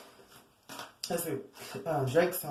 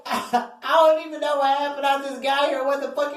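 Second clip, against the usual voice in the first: higher, baffled newcomer; mock confusion; 5 semitones higher than usual. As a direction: act lost and clueless. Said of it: "And you're like".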